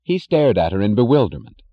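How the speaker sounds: ordinary talk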